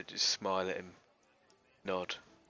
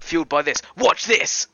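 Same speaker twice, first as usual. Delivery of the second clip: loud, high boastful shout; rowdy, triumphant announcement; drinker's bravado; 9 semitones higher, 12 dB louder than usual. Acted. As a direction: shout it like a daring show-off. Player boasts before another drink.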